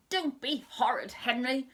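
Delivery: loud stern rebuke; shouting. Acted. Mum shouts at Henry for blaming Peter, loud rebuke. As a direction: snap the rebuke at him. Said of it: "shouted Mum".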